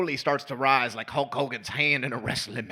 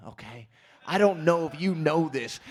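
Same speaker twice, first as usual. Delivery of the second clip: usual speaking voice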